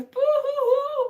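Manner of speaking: high mock boo-hoo sobs; mock sobbing. Mimicking reaction video viewers, mock sobbing. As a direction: fake a silly blubbering boo-hoo.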